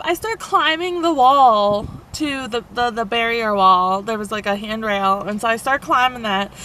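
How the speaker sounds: ordinary delivery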